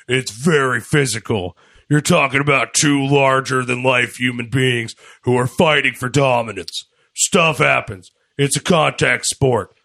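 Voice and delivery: put-on big wrestler voice; Braun. Host quoting a wrestler's comment, wrestler impression. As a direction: do a wrestler's interview voice. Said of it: "do this in my Braun voice".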